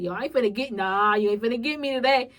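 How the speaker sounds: loud defiant retort. Retold or imitated. Refusing to let the moment win, loud and defiant. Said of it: "That's how my thought was".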